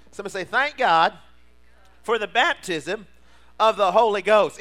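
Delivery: high, loud climbing sermon cry; preaching at full force. Acted. Pastor rousing the church to full force preaching.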